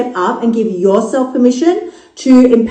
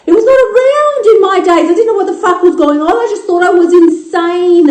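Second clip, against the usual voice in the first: much higher, fast, exasperated; high squeak; 8 semitones higher than usual. Retold or imitated. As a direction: squeal it like your younger self.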